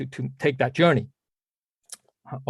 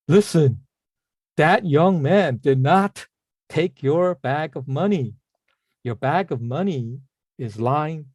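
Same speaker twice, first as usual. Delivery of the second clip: Guan Ying; slower than usual. Acted. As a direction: proclaim it slowly with measured pauses. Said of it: "way of imitating Guan Ying's voice".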